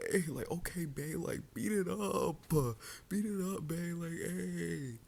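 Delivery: high, sing-song sarcastic "beat it up"; mocking fake cheer. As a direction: parody the cool girlfriend, dragging it out. Mocking the boyfriend she'd be.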